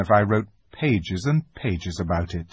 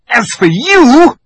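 higher and louder, slow threat; menacing witch; 14 dB louder, slower than usual. Acted. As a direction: turn on Edmund coldly. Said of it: "said the witch".

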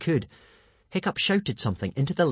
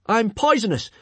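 high, loud warning yell; alarmed shout; 11 semitones higher, 6 dB louder than usual. A narrator's reading. Hiccup warning the hungry monster off, high alarmed shout. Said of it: "Hiccup shouted out".

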